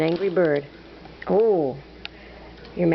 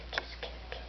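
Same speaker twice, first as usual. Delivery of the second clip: whisper; 19 dB quieter, much faster than usual. Acted. Whispering kisses to bird.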